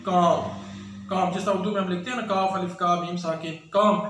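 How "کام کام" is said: کام with silent L, long vowel then M; correct pronunciation. Shown as a demonstration. Teacher corrects the mistake for learners, L-less کام. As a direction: drop the L entirely in کام. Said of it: "اس کا درست لفظ ہے".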